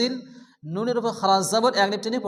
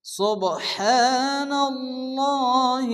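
slow, high, melodic tilawah; measured, reverent Quranic chanting; Quran recitation; 4 semitones higher, much slower than usual. Acted. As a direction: chant each phrase long and drawn out with pauses.

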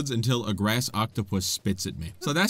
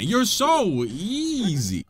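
slow sing-song gloat, raised pitch; gloating taunt; 5 semitones higher, much slower than usual. Real, not acted. Player taunting the boss, very drawn-out gloating. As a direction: drag out EASY mockingly.

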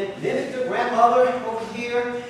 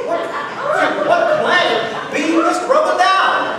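loud, high mock astonishment; comic outburst; 7 semitones higher, 9 dB louder than usual. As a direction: boom it out in theatrical bafflement. Acted baffled listener, comic outburst.

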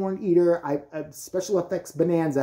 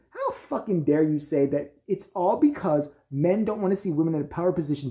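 ordinary talk, nothing marked

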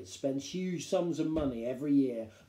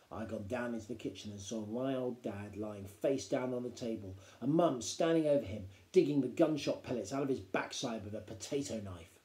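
ordinary delivery, nothing marked

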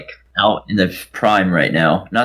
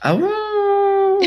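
surprised. Real, not acted. Reacting to werewolf dare.

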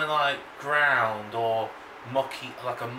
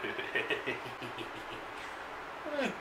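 giggle; 13 dB quieter than usual. Real, not acted. Laughing at the viewers.

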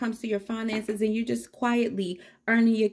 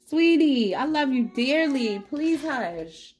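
higher, louder pleading to the bird; fond plea to hush; sweet scolding; 5 semitones higher, 4 dB louder, slower than usual. Real, not acted.

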